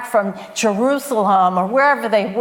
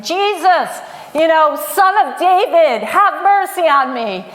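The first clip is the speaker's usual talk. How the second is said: loud, high desperate plea; desperate shout for mercy; crying out; 6 semitones higher, 5 dB louder than usual. Acted. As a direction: cry out to be heard over the crowd. Blind man crying out to Jesus.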